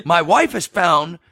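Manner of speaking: high, laughing mock of an upset husband. Husband on the phone complaining about the picture. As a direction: play the wronged husband, laughing through it. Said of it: "And he goes".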